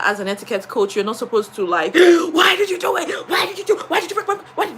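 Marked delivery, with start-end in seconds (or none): 2.0-4.8 angry outburst. Retold or imitated. Teacher shouting at the pupil over her broken phone. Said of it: "I was very angry".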